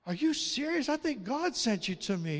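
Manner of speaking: retold amazement, lifted in pitch; amazed disbelief. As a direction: react as if help just turned up. Amazed passer-by in retold exchange.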